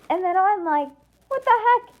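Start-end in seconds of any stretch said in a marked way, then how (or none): 1.3-1.9 high-pitched incredulous exclamation; startled disbelief. Acted. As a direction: blurt it out high, taken aback. Teller's startled reply to warning.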